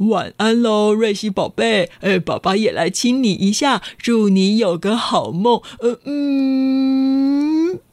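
lightly voiced gentle dad; father's goodnight. A narrator's reading. Dad saying goodnight to Ruixi, lightly voiced and gentle. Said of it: "听到爸爸说".

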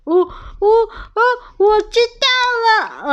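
high, slow scared stammering; a beaten bully giving in; frightened stammer. A narrator's reading. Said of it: "恶霸龙哭着逃走了". (恶霸龙害怕结巴)

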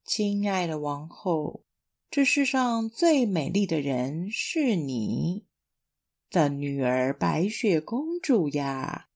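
slow, low magic mirror; shimmering echoing mirror voice. Acted. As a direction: intone it like an enchanted mirror. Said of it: "魔镜的回答".

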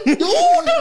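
high and loud outburst; retold exclaim. Brushing off his mother-in-law with a loud high outburst. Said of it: "gue bilang gitu".

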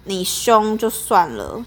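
ordinary talk, nothing marked

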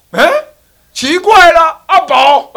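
high, loud startled call of a name; startled shout of the name; puzzled call. Acted. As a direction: call out in shocked surprise. Monk calls out, puzzled.

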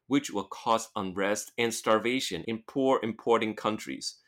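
slow the sentence down for learners; slow careful reading. Shown as a demonstration. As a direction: read "which will cause unrest" slowly, word by word. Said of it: "他说has been哈". (放慢速度朗读)

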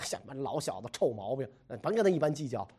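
low muttered brush-off; dismissive grumble. Acted. Emperor reading the rude letter, muttering dismissively. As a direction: grumble it under your breath, waving it away.

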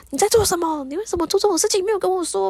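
high-pitched mock scolding; retold indignant demand; angry. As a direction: act out an angry husband, voice pitched well up. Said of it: "他就很生气的跑去抓住小".

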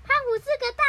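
loud, high teasing jeer; mock taunt. Controlled Suneo is made to insult Gian, taunting. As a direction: jeer it high like a cartoon kid.

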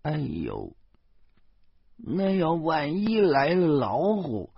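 lightly voiced old woman, fretting; a hint of a fussing old mum, slowing on the tiger; flustered old mother. Old mother fretting about tigers, lightly voiced and flustered. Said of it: "老妈慌张地说".

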